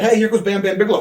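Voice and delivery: imitating a staged surprised cue; mock surprise. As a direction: play it like a fake reveal. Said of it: "Chronic acts surprised".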